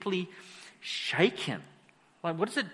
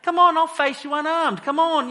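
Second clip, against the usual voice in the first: much higher and louder, swaggering challenge; cocky taunting shout; 10 semitones higher, 7 dB louder than usual. Retold or imitated. Goliath taunting the Israelite army, loud cocky shouts. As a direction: bellow it like a bully daring anyone. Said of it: "he's strutting around".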